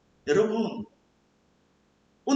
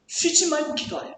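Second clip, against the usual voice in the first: higher-pitched, as an impossible demand; burdensome command; 7 semitones higher than usual. Acted. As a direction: relay it like an overwhelming order. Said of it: "어떻게 이렇게 쉬지 말고 기도할 수 있을까요?".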